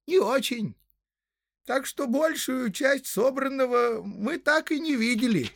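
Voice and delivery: very high, drawn-out hobbit voice; complaining hobbit. Acted. Hobbit explains the shortages to travellers, high and plaintive. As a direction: grumble slowly in a very high character voice.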